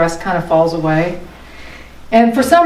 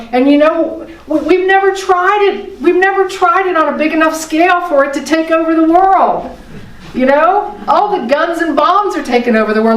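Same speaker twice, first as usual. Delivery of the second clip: rising excitement; 8 semitones higher than usual. Real, not acted.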